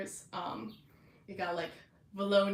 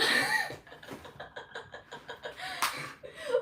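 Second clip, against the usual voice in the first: high laugh. Real, not acted. Laughing at the scene.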